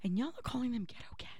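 unvoiced wounded whisper. Real, not acted. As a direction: whisper it like you're hurt. Hurt aside about foster cats.

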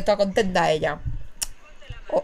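normal talking voice

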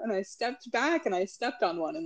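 usual speaking voice

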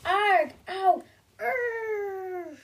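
high, loud hammed-up yelps; 1 comic pained cries. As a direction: yelp like you've been whacked. Hit parents crying out.

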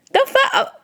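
high-pitched, incredulous outburst; shocked disbelief. Retold or imitated. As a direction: shoot up high, as if stunned. Acting out her shocked reaction.

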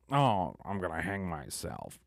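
loud mock-despairing "oh"; joking dramatic groan. Vlogger quoting their own dark jokes, mock despair. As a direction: toss it out as an exaggerated joke. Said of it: "even though I jokingly say".

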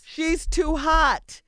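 drawn out, high and loud, leaning on hot; slow emphatic quote. Retold or imitated. Quoting what the guys told her, slow and loud. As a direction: stretch She's too hot out slowly.

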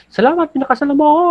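very high, fast, loud mock yell; angry-sounding thanks. Husband furiously yelling thanks to his wife, fast high mock shout. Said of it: "pag galit na galit ka na talaga".

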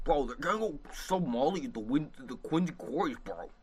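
bad Boston accent, half laughing. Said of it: "My Boston accent sounds like a Jimbo impression".

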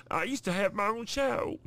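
playful cartoon voice on the word show; Cleveland voice. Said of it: "The Cleveland Show".

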